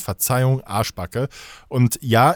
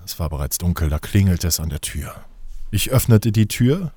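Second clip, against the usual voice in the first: lower, mock-solemn fairy-tale narration; mock solemn storytelling; 6 semitones lower than usual. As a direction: tell it like a Christmas legend, half joking. (feierlich-ironische Märchenerzählung)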